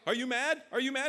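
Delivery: high, loud, urgent repeated question; alarmed questioning. Acted. Envoy checking the gasping president's anger, high and alarmed. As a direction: ask in mounting alarm.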